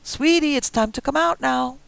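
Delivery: high, loud sugary coax through a door; old woman calling through the bathroom door, sugary; sweet old woman coaxing. Acted. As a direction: lure the kid out sweetly. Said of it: "Alma said through the door".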